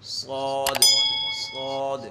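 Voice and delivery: صاد with a full, heavy s and long vowel; thick, heavy صاد. Shown as a demonstration. Teacher modelling the letter for students, صاد said heavily. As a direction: draw out صاد thickly, never thin. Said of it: "صاد کو موٹا کر کے ادا کیا جاتا ہے".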